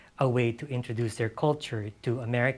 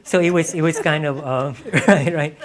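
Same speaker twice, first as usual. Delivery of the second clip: laughing through the words; 5 semitones higher, 12 dB louder than usual. Real, not acted. Novelist amused by audience reply, laughing.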